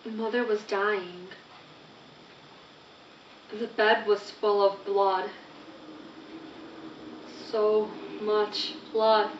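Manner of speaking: subdued, grief-stricken recital; hushed grief. Acted. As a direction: let each phrase fall quietly, in shock. Narrates finding mother dying.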